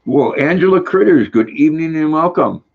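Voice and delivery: high, loud showman greeting; hearty welcome. Real, not acted. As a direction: welcome her like a host on stage. Greeting a viewer in chat.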